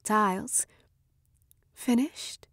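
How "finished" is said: voice like soft wind. Acted. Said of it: "in a voice like soft wind brushing across sand".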